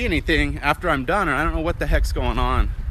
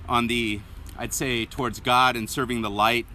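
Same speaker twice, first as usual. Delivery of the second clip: tired, breathy after a long walk; out of breath; 3 semitones lower, 8 dB quieter than usual. Real, not acted. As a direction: pant between phrases. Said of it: "Gotta catch my breath".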